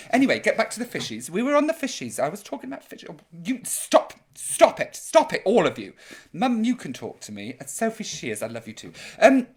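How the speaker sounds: ordinary delivery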